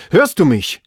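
insistent shouted question; shouting. A narrator's reading. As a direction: yell up to be heard. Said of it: "schrie er".

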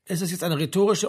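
ordinary delivery, nothing marked

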